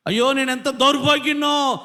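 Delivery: high, loud cry of woe; weeping lament. Acted. Preacher voicing a wretched sinner's cry, loud high lament. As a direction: wail over your own wretchedness. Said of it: "అది ఏడుపు కారణం".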